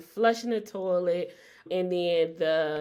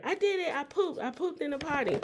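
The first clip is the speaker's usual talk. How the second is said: put-on high proud little kid; imitating toddler; 8 semitones higher, 4 dB quieter, faster than usual. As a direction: brag like a thrilled toddler. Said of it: "he'd look in the toilet like".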